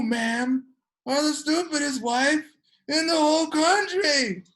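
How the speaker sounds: imitated angry yelling, pitched high; mock screaming husband. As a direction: berate her like an irate husband, dragging out the words. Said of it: "he starts screaming at her".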